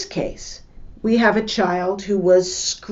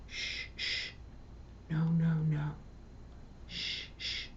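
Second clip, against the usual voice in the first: soft unvoiced shh to calm a child; a mother's soothing shush; shushing whisper; 5 semitones lower, 19 dB quieter than usual. Shown as a demonstration. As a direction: hush them gently in a whisper. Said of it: "She should model quiet voice".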